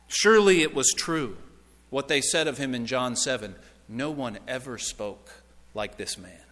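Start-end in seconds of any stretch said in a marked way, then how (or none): 3.9-6.4 hushed awe. Acted. Hushed quote voicing awed officers.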